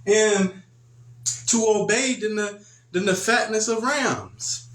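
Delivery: lightly voiced Samuel declaring obedience. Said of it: "He said".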